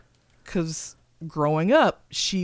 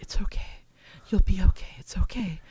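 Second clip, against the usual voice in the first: soothing whisper; 2 semitones higher than usual. Retold or imitated. Bergman holding a sobbing fan, whispering comfort. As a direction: hush her gently like comforting a child. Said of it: "she was like".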